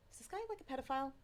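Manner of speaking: high, hushed inner question; suspicious thought. Retold or imitated. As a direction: wonder it under your breath, alarmed. Wary inner thought in ambulance.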